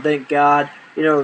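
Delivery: ordinary delivery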